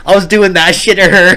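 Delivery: ordinary talk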